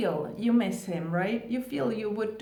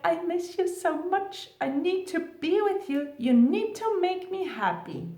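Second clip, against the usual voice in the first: high, pleading, clingy lover voice; needy clingy plea; 7 semitones higher than usual. Pretend. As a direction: beg him like you can't live without him. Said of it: "You're not gonna say that like that".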